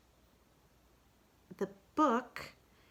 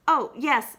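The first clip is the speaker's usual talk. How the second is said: louder, higher distracted yes; Kanga voice; 7 semitones higher, 10 dB louder than usual. Kanga answers while watching Roo, louder. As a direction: agree without attention.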